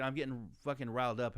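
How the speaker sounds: ordinary talk, nothing marked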